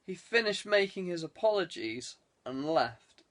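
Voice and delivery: ordinary delivery, nothing marked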